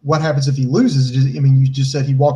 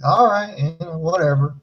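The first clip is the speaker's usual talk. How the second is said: incredulous retold reaction; 2 semitones higher than usual. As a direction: scoff at it in mock disbelief. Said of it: "I was just like".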